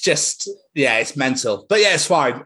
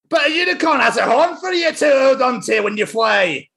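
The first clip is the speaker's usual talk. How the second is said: high Scots Susie, half laughing; Susie in Scottish; 9 semitones higher than usual. Acted. Reading Peppa book, high Scottish Susie. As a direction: protest it like a wee Scots girl. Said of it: "I'm gonna do it in my accent".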